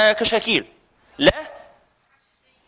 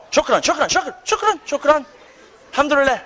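higher, quick grateful burst of thanks; relieved thanks; 7 semitones higher than usual. Real, not acted. Teacher thanking the staff for fixing the mic, in relieved excitement. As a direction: gush out thanks now the squeal has stopped. Mic squeal finally fixed.

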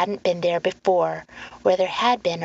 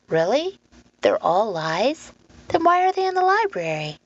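put-on higher, questioning girl's voice; challenging girl; 5 semitones higher than usual. Lenora challenging the man about the books, questioning. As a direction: question him with rising disbelief.

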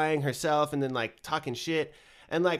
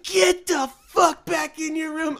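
much higher, slower, louder mock yell; mock angry parent yell; 6 dB louder, slower than usual. Parents ordering the kid back to her room, mock yell. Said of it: "And they're like".

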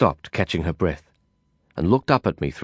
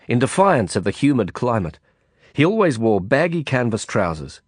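ordinary delivery

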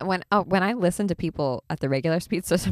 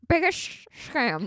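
slow, higher drawn-out quote; Caitlyn Jenner voice; 8 semitones higher, much slower than usual. Retold or imitated. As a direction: stretch it out like a celebrity soundbite. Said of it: "That was a Caitlyn Jenner quote".

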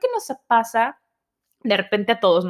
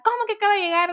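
baffled dad; 10 semitones higher, much faster than usual. Retold or imitated. Dad confused on the phone.

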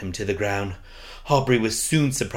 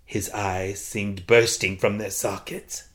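plain reading voice

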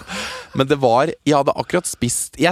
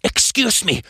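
higher, slow scolding English; stern spa employee; 7 semitones higher, slower than usual. Retold or imitated. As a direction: hush the noisy guests. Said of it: "bare sånn".

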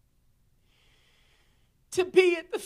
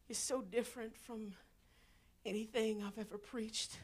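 hushed confession; 4 semitones lower, 8 dB quieter than usual. Real, not acted. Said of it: "soft confiding".

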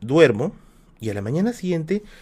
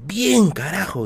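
grito contenido de festejo; 6 semitones higher, slower than usual. Retold or imitated. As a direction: imitate yelling in triumph at normal volume. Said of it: "grité, ¿no?". (grito de triunfo imitado, agudo y contenido)

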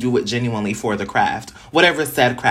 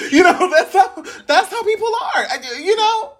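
much higher, real laughter through the words; laughing while talking. Real, not acted. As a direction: let the laugh push your voice way up. Laughing at own mimicry.

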